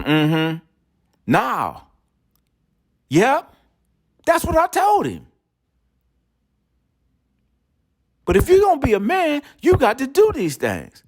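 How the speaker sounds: very high gossiping wife voice; wife on the phone. Retold or imitated. Wife tells her friend about him in a high gossiping voice.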